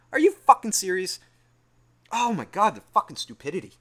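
high, loud disbelief; exasperated outburst. Real, not acted. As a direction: explode at the sheer stupidity. Exasperated outburst at retold accusation.

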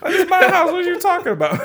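very high, laughing disbelief; indignant mock protest. Man retelling his comeback to a bathroom intruder, comically indignant. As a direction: squeal it in comic outrage.

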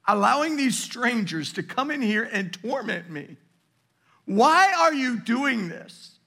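high, loud, slow pleading cry; voicing the frightened baby. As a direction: plead as a terrified toddler would. Father voicing crying baby's plea.

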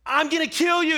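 put-on high, loud kid's battle cry; mock angry threat. Little brother charging his big brother, mock furious yell. Kid rushing at older brother.

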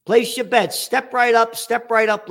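hammed-up sideshow barker pitch; carnival barker. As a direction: drum up the crowd like a fairground hawker. Mock carnival barker calling bets.

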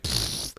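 dismissive raspberry; blowing a raspberry. Retold or imitated. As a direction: blow a quick unvoiced raspberry. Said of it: "You're like".

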